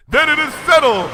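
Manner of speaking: loud, high royal boom; thundering. A narrator's reading. Luna announcing their departure, thundering. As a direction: declare it settled like a queen. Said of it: "thundered Luna".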